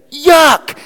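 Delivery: high, loud mock cry of revulsion; acted disgust. Child taking some medicine, acted loud disgust. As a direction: blurt it like a gagging child.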